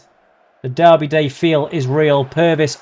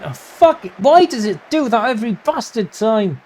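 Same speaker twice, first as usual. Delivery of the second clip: frustrated outburst; 7 semitones higher than usual. Real, not acted. Frustrated at the game.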